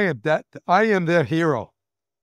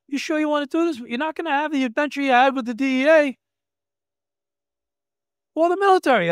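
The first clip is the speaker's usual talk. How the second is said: higher, louder doubtful questioning voice; imitating the district attorney; 11 semitones higher, 5 dB louder, faster than usual. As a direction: lightly voice the prosecutor's doubt.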